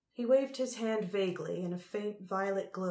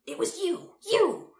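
high, loud accusing cry; shrill accusation; 11 semitones higher, 10 dB louder than usual. A narrator's reading. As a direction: accuse him, shouting the last word. Empress hurls accusation at brother.

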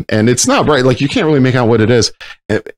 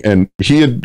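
ordinary talk, nothing marked

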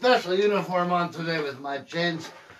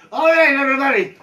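loud, high mock Mexican cowboy sign-off; mock Mexican accent farewell; 7 semitones higher, 9 dB louder than usual. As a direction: call out to the crowd like a hammed-up cowboy. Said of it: "Better drop that accent pretty quickly".

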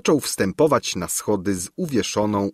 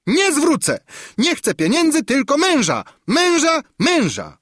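much higher, louder tantrum demanding a husband; furious screech; 4 dB louder than usual. A narrator's reading. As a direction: stamp and screech for her husband. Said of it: "zaskrzeczała ... tupiąc nogami z wściekłości". (histeryczny, wściekły skrzek hrabiny)

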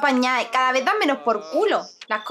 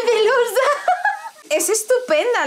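laughing; 8 semitones higher than usual. Real, not acted. Laughs at bad traits.

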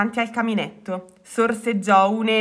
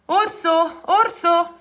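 high-pitched call to a friend; summoning someone from across the house; 7 semitones higher, much slower than usual. A narrator's reading. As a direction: call the name out twice, high and eager. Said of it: "lo chiamò Rana".

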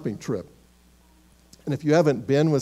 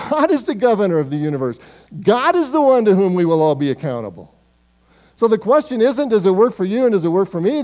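louder, emphatic preaching, hammering each phrase; preaching at full force; 3 semitones higher, 6 dB louder than usual. Acted. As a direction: preach it to the congregation with conviction. Preacher driving home his point.

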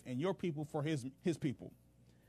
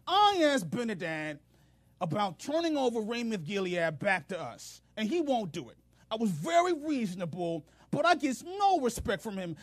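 higher, louder pouting complaint; moping whine; 7 semitones higher, 6 dB louder than usual. Retold or imitated. Ahab complaining to his officials, sulking whine. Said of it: "He's moping, he's pouting".

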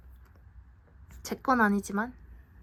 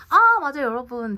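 sudden remembering; 3 semitones higher, 11 dB louder than usual. Real, not acted. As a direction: blurt it out as it comes back to you. Suddenly remembers something to show. (갑자기 생각난 듯 크게)

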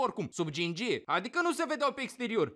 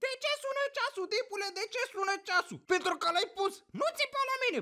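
high-pitched irritable squabble; acted bickering; 8 semitones higher than usual. Couple arguing over the alarm clock, mock irritated. Acted morning quarrel with partner.

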